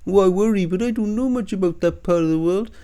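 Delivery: high, put-on fretful tone; mocking whiny voice. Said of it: "he comes out with that shit".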